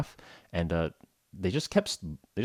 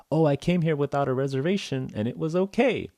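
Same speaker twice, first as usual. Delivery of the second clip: high, put-on reviewer voice; pleased reviewer; 8 semitones higher than usual. Reviewer reports getting in without booking, high pleased voice. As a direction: relay praise in a high, pleased voice.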